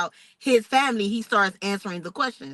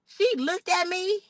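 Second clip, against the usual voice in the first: ordinary talk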